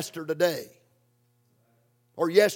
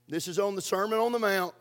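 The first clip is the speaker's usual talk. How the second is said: ordinary talk, nothing marked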